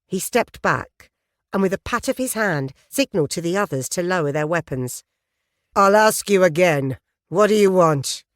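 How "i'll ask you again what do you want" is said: louder, hard biker demand; loud, menacing repeated demand; stern biker. Acted. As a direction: press her for an answer.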